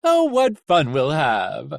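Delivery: slow, loud villainous delight; gleeful villain. Acted. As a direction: relish it slowly like a showy villain. Gleeful villain mocking his prey.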